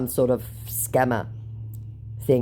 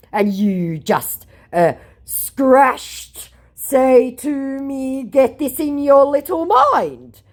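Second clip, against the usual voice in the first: very slow, higher and louder; mock scammer voice; 10 semitones higher, 6 dB louder, much slower than usual. Quoting the scammer's muddled threat, slow and high. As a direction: spell it out menacingly.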